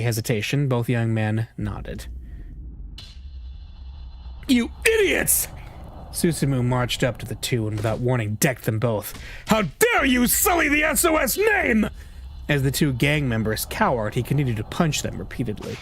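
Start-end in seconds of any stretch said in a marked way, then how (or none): 4.5-5.5 high, slow, enraged outburst; furious yell. Acted. Gang leader furious at his men. 9.5-11.9 high, slow bellow of rage; furious shout. Acted. Gang leader punching his men.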